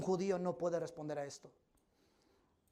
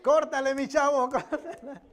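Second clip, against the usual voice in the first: laughing cut call; 5 semitones higher, 7 dB louder than usual. Real, not acted. Laughing, telling helper to cut.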